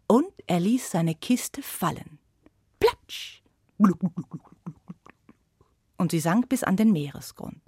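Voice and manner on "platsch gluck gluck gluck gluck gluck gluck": splash then sinking gluck sounds fading. Acted. Narrator voicing box sinking into sea, fading glucks. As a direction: make the box sink with sound.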